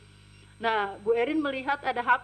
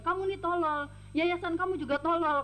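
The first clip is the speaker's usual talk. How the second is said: higher-pitched mock scolding; retold angry insult; 6 semitones higher, 4 dB quieter than usual. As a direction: imitate an irate boss hurling insults. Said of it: "jadi dia marah".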